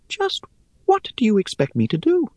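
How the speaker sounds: high, airy, scornful little voice; airy spider voice. Acted. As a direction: chide them, light and dry.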